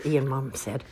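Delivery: plain reading voice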